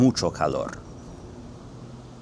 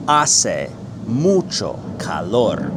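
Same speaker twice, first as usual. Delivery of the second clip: slow Spanish, one word at a time; drawn-out teacher demonstration; 4 dB louder, much slower than usual. Shown as a demonstration. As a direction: separate each word for learners. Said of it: "word for word".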